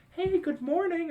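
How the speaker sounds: high, slow chirpy mother greeting; mom's voice. Retold or imitated. Mom waking her hungover son by phone, high and sweet.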